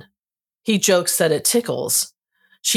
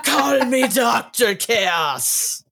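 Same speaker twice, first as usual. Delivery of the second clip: dramatic villain voice; 6 semitones higher, much slower than usual. Retold or imitated. Reading villain line, laughing.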